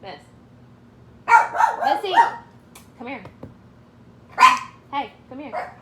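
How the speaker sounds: high, loud call to the dog. Real, not acted. Calling the dog.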